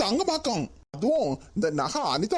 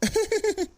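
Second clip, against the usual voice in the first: giddy laugh; 11 semitones higher than usual. Acted. Groom laughs at the wedding.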